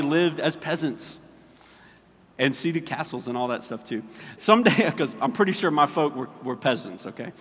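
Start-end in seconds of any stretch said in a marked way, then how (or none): none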